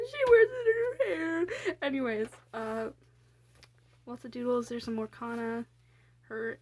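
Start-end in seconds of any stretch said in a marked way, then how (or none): 0.1-1.8 high, loud, drawn-out squeal of adoration; squealing, half-crying with fondness; mock-tearful gushing. Real, not acted. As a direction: wail it as if overcome by cuteness. Gushing over own sweet comic.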